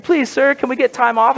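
high-pitched pleading worker; timid petitioner. Retold or imitated. Worker asking Scrooge for time off, hammed-up meek pleading. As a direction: beg the boss in a put-on high voice. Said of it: "something Ebenezer Scrooge would say".

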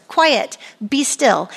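slow it down and let each word land; slow emphatic command. Shown as a demonstration. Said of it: "Three words".

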